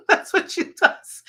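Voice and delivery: breathy laugh through the words. Real, not acted. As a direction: laugh it out as you say it. Laughing about the dog.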